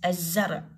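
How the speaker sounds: الزرع with a doubled z and no L; silent lam. Shown as a demonstration. Teacher repeats the word for pupils, lam silent. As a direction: say الزرع as "az-zar". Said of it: "اللام ما لفظت".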